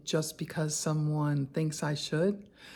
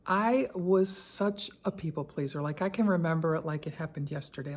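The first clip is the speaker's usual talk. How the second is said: ordinary talk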